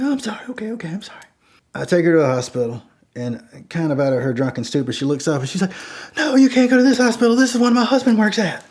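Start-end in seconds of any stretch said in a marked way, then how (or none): 0.0-1.2 high, breath-thin mock whimper; drunk, tearful apology. Drunk woman apologizing to driver, high thin whimper. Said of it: "she's drunk and she's still kinda crying". 6.2-8.6 high, loud mock wail; panicked drunk protest. Drunk passenger spots husband's hospital, loud high protest.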